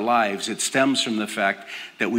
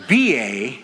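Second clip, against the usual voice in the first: ordinary talk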